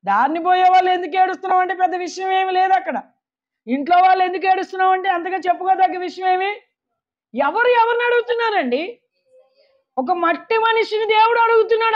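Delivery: high, emphatic sermon cry; impassioned pulpit exclamation; full-force preaching. Real, not acted. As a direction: drive each point out to the congregation.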